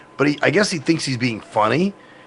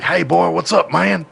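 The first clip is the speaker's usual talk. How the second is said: higher-pitched country drawl imitation; thick southern accent; 4 semitones higher than usual. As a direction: mimic a Southern country buddy's greeting. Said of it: "he had a real thick".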